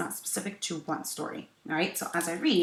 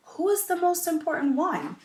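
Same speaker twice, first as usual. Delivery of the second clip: put-on boy's voice; 12 semitones higher, 8 dB louder than usual. Nikoli asks his question.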